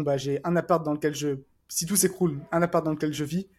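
ordinary talk, nothing marked

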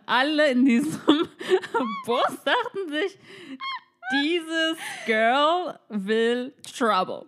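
high, slow, broken by laughter; teasing, laughing voice of onlookers; mock-dramatic verdict. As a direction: draw it out while cracking up. Voices the bus passengers' thought.

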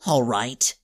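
sudden steely hero; deadly determined. Acted. Seymour, steeling himself for the fight, low and grim. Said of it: "a look of deadly determination".

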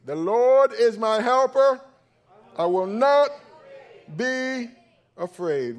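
The Lord is my helper, loud, high and slow; a pastor showing the congregation how to declare it, loud; said like you mean it. Shown as a demonstration. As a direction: proclaim it with full conviction. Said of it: "say it like they mean it".

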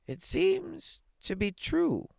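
put-on high, slow old astronomer voice. Astronomer after looking through his telescope, old man's voice. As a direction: draw it out, pitched far up.

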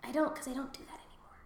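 fast unvoiced confession; quick embarrassed whisper; whispered aside. Real, not acted.

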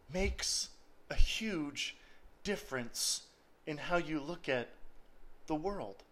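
soft, low intimate preaching voice; hushed preaching. Acted. Preacher softly telling the congregation God holds them, hushed. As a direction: draw them in gently, almost confiding.

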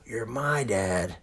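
put-on slow, stretched child's protest; imitating protective kids. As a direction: mimic a possessive kid dragging out the words. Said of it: "They get very protective".

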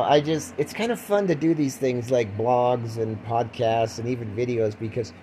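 ordinary delivery

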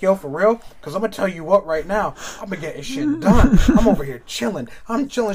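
loud, high, buzzing with caffeine; hyped up like he's had too much coffee; wired and jittery. Retold or imitated. Friend on too much coffee insisting he's chilling, wired and loud. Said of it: "you're fucking wired".

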